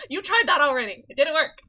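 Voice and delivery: a sudden loud declaration; character line delivered loudly; loud exclamation. Acted. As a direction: blurt it out loudly. Game line read out loud.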